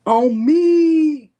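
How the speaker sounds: high, loud punchy "On me"; sing-song loud "On me" for effect; emphatic repeat. Shown as a demonstration. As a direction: belt out "On me" with flair. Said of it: "Just wanted to say it like that".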